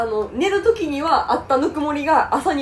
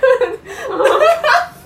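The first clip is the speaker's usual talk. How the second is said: loud laugh; 11 semitones higher, 5 dB louder than usual. Real, not acted. Friend's over-long gushing.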